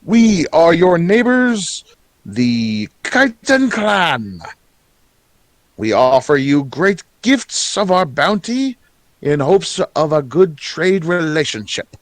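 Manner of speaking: high, grandly announcing goblin emissary; drawn-out, high-voiced herald's address; goblin clan envoy.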